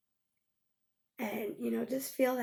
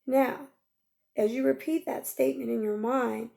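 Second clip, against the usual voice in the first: usual speaking voice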